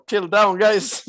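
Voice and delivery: called out high and loud at the chat; mock exasperated shout. Real, not acted. Streamer overwhelmed by chat, telling viewers to calm, loud playful shout.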